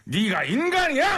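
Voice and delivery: angry retort. Shown as a demonstration. Repeating the co-host's line, slow and outraged. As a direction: drag out 네가 인간이야 in outrage. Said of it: "한 번 더".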